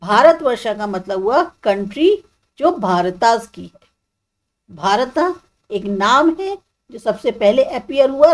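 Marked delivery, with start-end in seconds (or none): none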